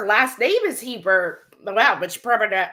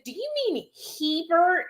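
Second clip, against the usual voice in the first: high-pitched tentative question; pointed correcting question; 8 semitones higher than usual. Retold or imitated. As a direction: ask it pitched well up. Acts own correcting question.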